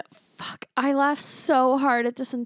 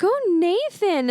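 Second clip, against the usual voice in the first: mock flirty heroine; 8 semitones higher, slower than usual. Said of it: "And she's like".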